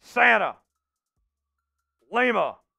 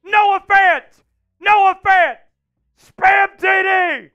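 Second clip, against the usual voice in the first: much higher, louder celebration yell; touchdown shouting; 10 semitones higher, 5 dB louder than usual. Real, not acted. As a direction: scream it like the winning score. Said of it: "I'm shouting them out!".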